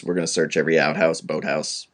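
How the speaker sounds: low, brisk lawman rundown; Tommy Lee Jones spiel. Acted. Host mimics The Rock's trailer spiel, low fast lawman impression. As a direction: rattle off the search list low and fast. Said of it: "his Tommy Lee Jones".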